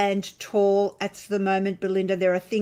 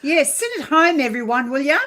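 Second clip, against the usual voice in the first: mock scolding; 6 semitones higher, 7 dB louder, faster than usual. Mock scolding viewers to stay home.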